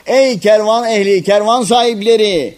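loud, high herald's call; shouting after them. A narrator's reading. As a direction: call after a departing caravan. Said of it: "Arkalarından bağırdı".

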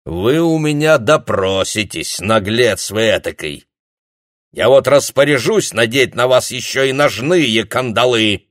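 loud, high furious threat; angry threat. Acted. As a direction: bellow the threat at him. Habbiker threatens the prisoner. (громкая злобная угроза)

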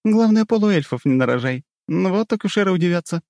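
high teasing secretary voice; smirking teasing. Acted. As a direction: tease her with a smirk. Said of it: "ухмыльнулась Жанна".

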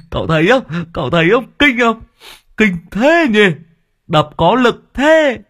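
high, boastful bragging; gloating. Acted. Servant bragging to his master, high gloating. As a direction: brag up high, proud of the hit. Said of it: "đắc thắng".

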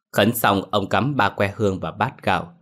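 ordinary delivery

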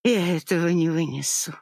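usual speaking voice

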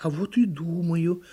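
mild turtle defiance; retorting turtle. A narrator's reading. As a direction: answer back as a little turtle.

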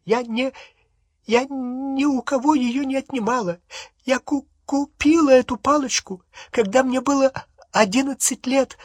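very high, stammering through tears; acted tearful, high-pitched stutter; weeping protest. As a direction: plead your innocence between sobs, breaking up the words. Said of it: "расплакалась пуще прежнего".

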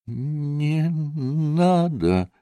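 very slow, soft, drawn-out plea; faint plea. A narrator's reading. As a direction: stretch each word out weakly. Sick boy pleads with his friend.

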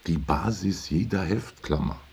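soft, measured musing; brooding recollection. Acted. Husband broods in bed over a phrase from work, quietly.

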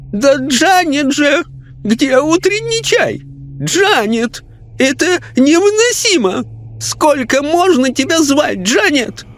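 high, demanding lady's call; woman's voice calling. A narrator's reading. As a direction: summon the maid from bed. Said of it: "женским голосом произнёс".

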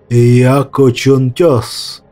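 slow, grand serpent proclaiming; majestic serpent. Acted. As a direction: proclaim your name like an ancient serpent. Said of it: "величественно произнёс змей". (величественный голос змея)

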